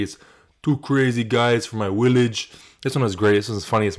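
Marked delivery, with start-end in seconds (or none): 0.6-2.5 slow, lightly put-on Czech accent; imitating Yuri. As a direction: quote him the way everyone does, drawn out. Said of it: "Every time you bring up Yuri".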